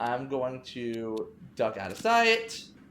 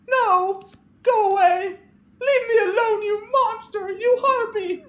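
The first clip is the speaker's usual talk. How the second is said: much higher, much louder accusing scream with a cry; Clarissa screaming; 8 dB louder, slower than usual. Acted. Grieving Clarissa accuses Veronica through the locked door, screaming. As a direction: hurl it at her like she murdered him.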